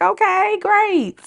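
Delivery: very high, airy brush-off; mock agreeable. Host answering those who ignore her advice, mock agreeable. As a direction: pitch it way up in feigned acceptance. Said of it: "I be like".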